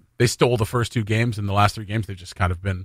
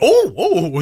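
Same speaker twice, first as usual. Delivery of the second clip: higher-pitched imitation of delighted surprise; excited surprise; 8 semitones higher than usual. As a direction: act pleasantly caught out. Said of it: "like, all excited".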